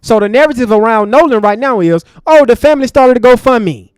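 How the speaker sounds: loud opening of an angry rant. Real, not acted. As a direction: open hot and loud.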